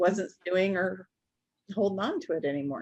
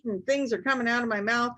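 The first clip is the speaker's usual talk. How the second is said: ordinary talk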